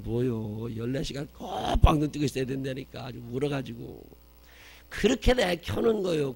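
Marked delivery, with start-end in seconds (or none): 4.9-6.3 put-on high, loud wailing cry of a fussy baby; mock wailing child. Preacher imitating the baby crying on the flight, loud and high. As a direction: wail it like the screaming kid on the plane.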